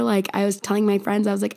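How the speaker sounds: normal talking voice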